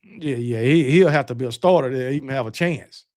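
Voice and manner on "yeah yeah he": ordinary delivery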